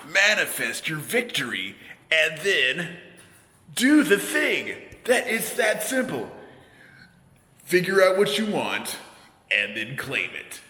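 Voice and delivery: high, forceful self-help pep talk; hammed-up motivation. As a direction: pump it up like a motivational coach. Mock motivational pep talk to himself.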